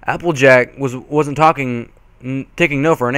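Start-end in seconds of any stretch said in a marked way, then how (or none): none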